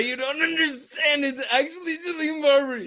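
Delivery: high, excited disbelief; starstruck insistence. Real, not acted. Telling cohost it's actually Jillian Barberie, high and excited. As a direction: insist it's really her, thrilled.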